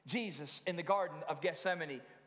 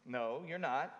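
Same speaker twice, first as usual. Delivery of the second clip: ordinary talk, nothing marked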